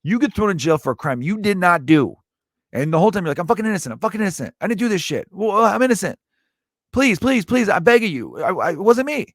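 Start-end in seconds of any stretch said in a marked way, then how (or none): 3.4-9.3 desperate high pleading, begging for belief; rising into a high desperate plea; pleading prisoner. Retold or imitated. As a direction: beg like a wrongly jailed man.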